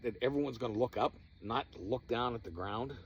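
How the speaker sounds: ordinary talk, nothing marked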